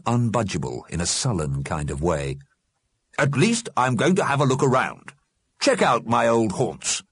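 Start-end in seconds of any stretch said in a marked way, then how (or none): none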